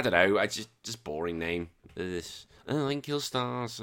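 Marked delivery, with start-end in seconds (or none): 2.7-3.8 slow, softer put-on voice; mock dull character. Mocking Star Killer's boring name, slow dull put-on voice. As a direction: play a boring hero, slow and quiet.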